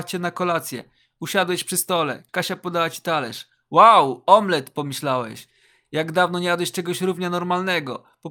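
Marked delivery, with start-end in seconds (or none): 3.7-4.7 loud, punched exclamation; impressed wow. A narrator's reading. Hungry guest seeing his plate, loud impressed wow. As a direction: exclaim it loudly, wowed by the food. Reader voices delighted thought at omelette.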